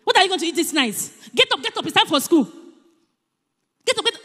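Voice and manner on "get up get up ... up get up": much higher, bossy yell; harsh wake-up. Shown as a demonstration. Parent yelling at a child to wake for school. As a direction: bark at the child to get up. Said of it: "A soft answer".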